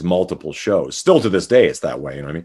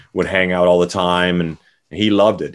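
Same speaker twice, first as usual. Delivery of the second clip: normal talking voice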